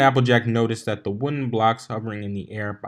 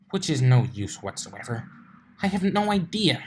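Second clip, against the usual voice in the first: Twilight's voice; 5 semitones higher than usual. A narrator's reading.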